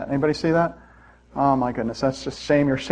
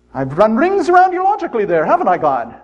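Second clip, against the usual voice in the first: mock gloat; 6 semitones higher than usual. Gloating at God.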